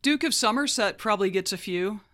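ordinary delivery

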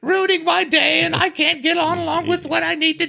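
high, whiny complaining voice; grumpy onlooker voice. Retold or imitated. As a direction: whine it like an annoyed neighbour. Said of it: "And then they were like".

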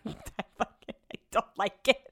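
words breaking up into real laughter; laughing. Real, not acted. Podcaster admitting her elbow looks flappy, laughing. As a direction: squeeze the words out through a laugh.

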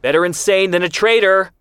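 high, loud parting shot; called after him. Acted. Ben Shemen shouts after Zvi as he walks away, loud. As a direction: throw it after him across the room. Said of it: "Ben Shemen called after him".

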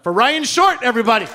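rousing stage introduction. Real, not acted. Host brings comedian on at a live taping, rousing call. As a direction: belt out the name to bring the act on.

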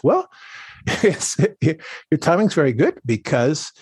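high, lilting retold quote; sing-song voice. Botanist friend welcomes the caller back, in a light sing-song. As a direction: lightly imitate a sing-song voice, half laughing. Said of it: "in this sing-song voice".

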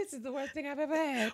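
mock polite British accent. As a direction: sound sweetly polite while insulting, British style. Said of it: "it's like".

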